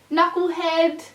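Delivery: ordinary talk, nothing marked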